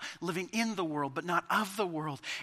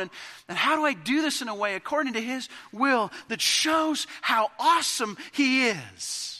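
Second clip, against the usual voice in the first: louder, rising plea, slowing at the end; earnest preaching question; 5 semitones higher, 6 dB louder than usual. Acted. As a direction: ask it like a heartfelt prayer. Voicing the believer's question.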